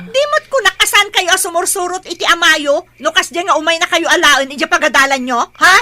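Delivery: high, loud furious rebuke; angry scolding. Acted. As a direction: snap at the kids in anger. Mother scolds children coming home late. (nakapungtot a panagungor iti annak)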